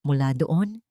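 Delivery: plain reading voice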